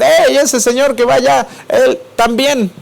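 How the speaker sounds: señalando y gritando, agudo; acusación gritada. Retold or imitated. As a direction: acusa a gritos al que va allá. (grito de acusación a Pedro)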